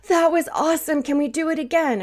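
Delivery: high, bright-eyed burst of joy, imitating a kid; bouncing with delight after the coaster; thrilled boy. Grandson after his first coaster, thrilled and begging to go again.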